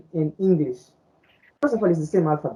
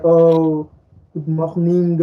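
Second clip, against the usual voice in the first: imitated French-accented English; 4 dB louder, much slower than usual. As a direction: mimic a French speaker's English. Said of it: "when French speak English, it's like".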